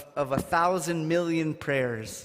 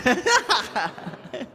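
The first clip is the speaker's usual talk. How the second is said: high burst of laughter; 9 semitones higher, 5 dB louder than usual. Real, not acted. Laugh after song line.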